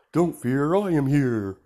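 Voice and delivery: hammed-up hero announcing arrival; booming hero line; All Might voice. All Might quote in retold story.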